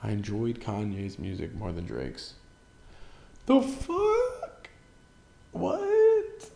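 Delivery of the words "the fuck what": squeaky high incredulous yelp; mock disbelief. Shocked at liking Donda more, high squeaky yelp. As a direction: shriek it in fake shock.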